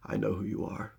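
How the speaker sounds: low, soft threat delivered up close; quiet menace. Retold or imitated. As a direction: hush it right into his face. Eli threatening the bully.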